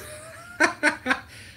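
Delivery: high burst of laughter; real laugh at your own mistake; high, breathy chuckling; amused laugh. Real, not acted.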